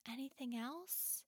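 hushed whisper; whispered question. Acted. Henny quietly questions Pela, whispering. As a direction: ask softly.

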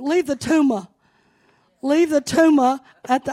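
loud, high fiery preacher call; New Orleans preaching. Shown as a demonstration. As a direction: belt it out like a revival preacher. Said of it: "I have to do my New Orleans stuff".

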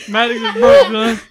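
high mock Maddox voice, loud; lightly voiced Maddox laughing fit; imitating Maddox. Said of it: "What Maddox sounds like over there".

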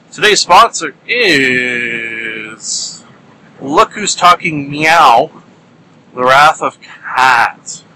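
slow, drawn-out announcer read; mock sponsor announcement. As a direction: stretch it out like a grand sponsor reveal.

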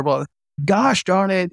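high, slow retold grumble; mock exasperation. Doctor imitating the vice president swearing at him, slow mock exasperation. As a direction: ham up the boss's irritation a little. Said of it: "I'm paraphrasing".

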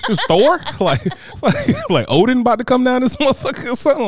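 high, laughing disbelief at a silly mishearing. Real, not acted. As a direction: crack up in disbelief while asking. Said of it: "incredulous laughing retelling".

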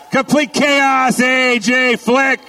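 high, slow shouted introduction; announcer shout. Acted. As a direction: call out the winner's name to the crowd.

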